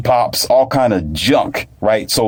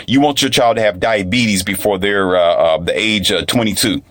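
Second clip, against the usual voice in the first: ordinary talk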